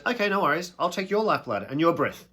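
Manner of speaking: high, loud, casual agreement; God's breezy reply. Retold or imitated. God casually agreeing to take their lifeblood, high and breezy. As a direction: toss it off lightly, as if easy. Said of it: "then God goes".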